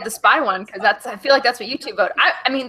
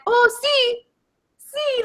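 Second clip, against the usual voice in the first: high-pitched mock Italian; light imitation of an Italian speaker, pitched up; Marco Polo; 12 semitones higher, much slower than usual. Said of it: "speaking Italian".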